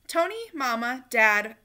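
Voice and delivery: ordinary delivery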